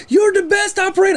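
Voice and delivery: excited high voice. Retold or imitated. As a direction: recognise a friend and squeal up high. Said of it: "Doesn't he have the high voice?".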